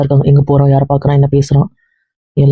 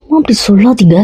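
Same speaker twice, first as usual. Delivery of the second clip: begging; 6 semitones higher than usual. Acted. Begging mother to stop.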